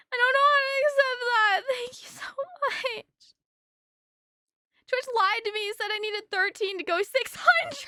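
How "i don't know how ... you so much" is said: fighting back tears, pitch up; choked up. Real, not acted. Overwhelmed streamer thanking chat, near tears. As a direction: voice cracking with tears. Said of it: "I'm gonna cry".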